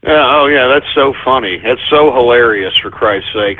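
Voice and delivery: low, deadpan mock appreciation; sarcastic. Real, not acted. Host reacting to a prank call, weary sarcasm. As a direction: drop the pitch low and sound thoroughly unamused.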